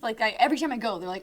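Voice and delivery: ordinary talk, nothing marked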